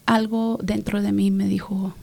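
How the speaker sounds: ordinary talk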